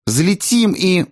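put-on high, eager girl cut off mid-plan; eager daughter. A narrator's reading. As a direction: start the plan in a high voice, breaking off. Дочь продолжает, её обрывают. (азартный высокий девичий голос)

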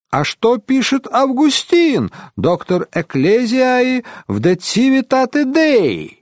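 high, slow declamatory outcry; exclaiming. A narrator's reading. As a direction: proclaim it like a learned challenge. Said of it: "воскликнул один из паломников".

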